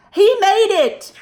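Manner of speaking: triumphant. A narrator's reading. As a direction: celebrate the success. Triumphant narration.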